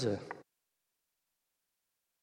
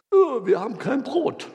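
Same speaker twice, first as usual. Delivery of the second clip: mocking whine; 11 semitones higher, 4 dB louder than usual. Mocking the disciples' worry.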